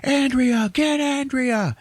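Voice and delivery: high, drawn-out yell for Andrea; mock movie-hero holler; urgent calling out. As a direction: call out across the chaos, stretching the words. Acted urgent call in retold film.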